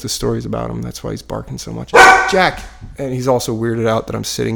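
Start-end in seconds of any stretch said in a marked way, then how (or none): 1.9-2.6 sharp, high, loud call; real raised-voice scolding of a dog. Real, not acted. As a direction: shout the dog's name to stop the barking. Calls out to barking dog.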